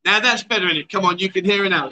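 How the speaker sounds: ordinary talk, nothing marked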